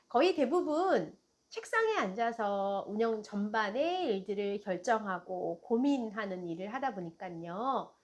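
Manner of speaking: usual speaking voice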